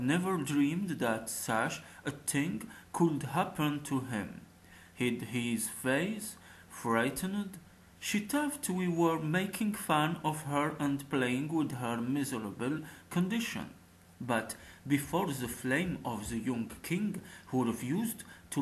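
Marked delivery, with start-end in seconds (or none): none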